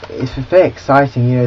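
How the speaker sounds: ordinary talk, nothing marked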